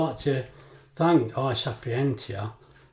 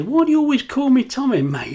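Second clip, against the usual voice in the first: 1 high mock voice; 11 semitones higher, 7 dB louder than usual. Mate's voice in retold story.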